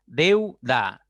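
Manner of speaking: "Deuda" loud, split deu, da; syllable by syllable. Shown as a demonstration. Teacher showing the word's division, "Deuda" in two firm syllables. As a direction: hit deu as one beat, then da in "Deuda". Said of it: "al momento de hacer la separación silábica".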